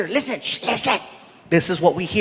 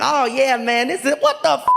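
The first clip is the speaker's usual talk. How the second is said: dawning shock. Retold or imitated. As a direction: cheer that snaps into outrage. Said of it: "his voice changed".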